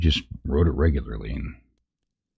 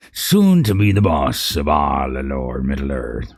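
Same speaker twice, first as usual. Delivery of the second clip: boastful narc. Acted. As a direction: crow over the prisoners like a brute.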